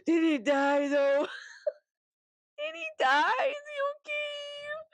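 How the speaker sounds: gasping awake into a high, stretched wail; mock panic. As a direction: plead for news in hammed-up dread. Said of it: "a mini panic attack blackout".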